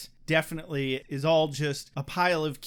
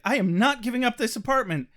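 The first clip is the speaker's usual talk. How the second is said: indignant; 6 semitones higher, 6 dB louder than usual. Retold or imitated. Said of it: "She's like".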